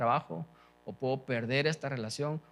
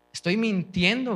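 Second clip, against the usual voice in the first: raised, slow, pleading confession; anguished prayer; 6 semitones higher, 7 dB louder than usual. Acted. A sinner pleading aloud to God, slow and raised.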